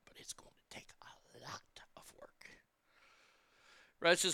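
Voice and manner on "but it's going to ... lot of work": hushed whisper to close the point. Acted. Closing the rant about stupidity in a whisper. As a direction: drop to a breathy secret whisper.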